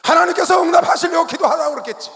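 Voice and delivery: shouted sermon line, high and loud; preaching at full force. Real, not acted. Pastor shouting to the congregation about prayer. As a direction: thunder it out to the congregation.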